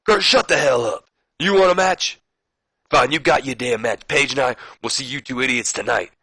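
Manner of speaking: imitating a heated promo shout at Kurt; mock Booker T tirade. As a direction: bark it at Kurt like a fed-up wrestler on the mic. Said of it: "Booker T just like".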